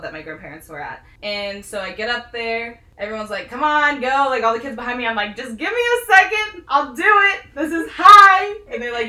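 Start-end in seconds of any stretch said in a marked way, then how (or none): 3.5-4.2 louder, higher mimicked heckling; urging kids. Kids behind her urging her on. 5.4-8.5 loud, high-pitched mock panic; panicked protest. Retold panic on the diving board.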